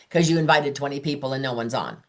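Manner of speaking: ordinary delivery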